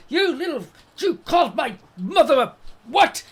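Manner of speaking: mock squabbling child, loudly affronted; acted kid's outrage. One scrapping kid accusing the other, loud mock outrage. Acting out two kids fighting.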